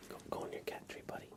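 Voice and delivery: ordinary talk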